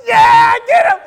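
loud, high shout of encouragement; cheering on. Retold or imitated. Kids cheering Ralphie against the bully, excited shout. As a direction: egg him on at full shout.

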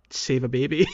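normal talking voice

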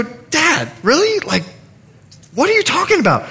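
high, eye-rolling disbelief; mock a kid who can't believe his dad; incredulous teen. Teenage son to his praying dad, high incredulous whine. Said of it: "They'll look at you and go".

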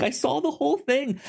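high, whimpery imitation of a crying boy; imitating crying kid. Young Ekko crying after Vander dies, imitated whimper. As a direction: squeak it out like a tearful child. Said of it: "he's crying, just being like".

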